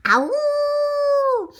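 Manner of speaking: high, loud howl; wolf howl. Acted. Wolf howling over the plan, high loud howl. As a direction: throw your head back and howl. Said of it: "he let out a howl".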